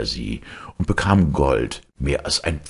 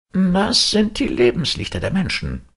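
kindly Death, voiced much higher; Death's voice. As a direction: speak as gentle Death, pitched up. Death explains the lights.

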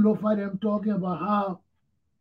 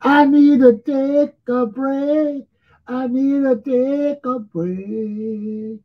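very slow, higher and louder, stretched through a yawn; 8 semitones higher, 6 dB louder, slower than usual. Real, not acted. Tired host telling viewers he needs a break, yawning. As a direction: groan out the need for a break mid-yawn. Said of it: "I'm yawning too much".

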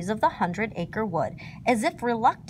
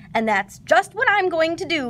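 higher, louder bouncy glee; laughing Tigger; 7 semitones higher, 5 dB louder than usual. A narrator's reading. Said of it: "laughed Tigger".

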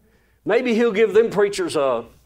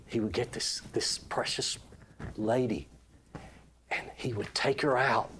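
hushed sneaking whisper; 9 semitones lower, 11 dB quieter than usual. A narrator's reading. Preacher telling the midnight wedding story to his congregation, hushed whisper. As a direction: tell it like you're sneaking past a sleeping house.

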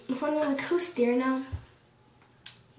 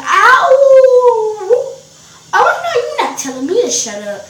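acted outrage; 10 semitones higher, 13 dB louder, faster than usual. She reacts to boy's shut up, acted outrage. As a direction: howl it, then refuse loudly. Outraged reply to being silenced.

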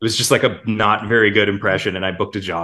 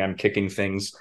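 ordinary talk, nothing marked

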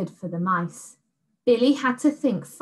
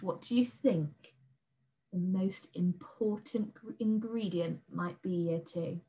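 a secret hushed question; whispered aside; 8 dB quieter than usual. Shown as a demonstration. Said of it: "aside to children".

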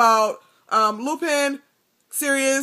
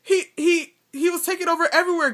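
gasping; 7 semitones higher, faster than usual. A narrator's reading. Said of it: "gasped Pettigrew".

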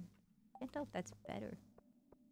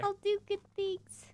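high mock voice; 13 semitones higher than usual. Mocking high voice reply.

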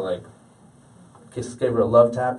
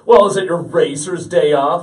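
loud, raised mock heckle; loud teasing buddy; 4 semitones higher, 9 dB louder than usual. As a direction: imitate a buddy needling you loudly. Imitates buddy teasing him.

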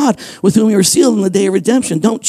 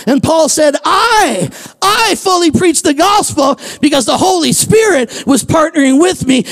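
raised, shouted preaching build; full-force preaching; 4 semitones higher than usual. Acted. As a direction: preach it hard, pushing every line.

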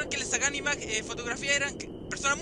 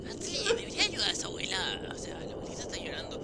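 whisper; 3 semitones higher, 5 dB quieter than usual. Acted. Voz interior que le habla.

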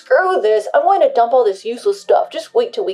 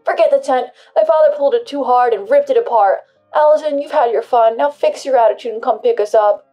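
angry demanding; 3 semitones higher, 10 dB louder than usual. Acted. Husband demands pickup.

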